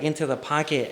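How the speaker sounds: normal talking voice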